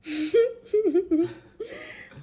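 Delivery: high burst of laughter; bright laugh. Real, not acted. Laughing at her own blackout.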